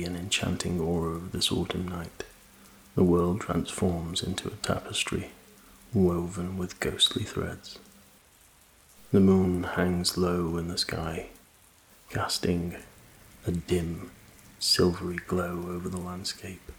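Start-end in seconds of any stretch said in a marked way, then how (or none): none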